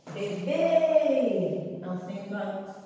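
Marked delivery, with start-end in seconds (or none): 0.1-1.8 Bebê with a loud, high, held BÊ; stress on last syllable. Shown as a demonstration. Teacher showing where the circumflex goes, final BÊ drawn out. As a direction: stretch the closed "bê" of "Bebê". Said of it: "acento circunflexo no E".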